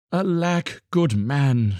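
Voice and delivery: ordinary delivery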